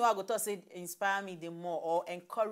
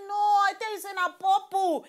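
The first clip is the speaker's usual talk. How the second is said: high, loud imitated contradiction; irritated retort; 13 semitones higher, 10 dB louder than usual. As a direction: act out an exasperated correction. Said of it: "E dey irritate me".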